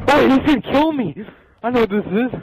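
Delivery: high frightened shout; panicked yelp at the monster; scared horror game streamer. Real, not acted.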